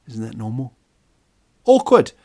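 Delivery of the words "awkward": very high, loud squeal of awkward; squeaky awkward. Real, not acted. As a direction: squeal it to break the tension. Comic reaction to own joke.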